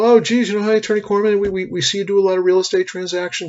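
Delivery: company sales caller. Retold or imitated. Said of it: "I get a phone call one day from the company".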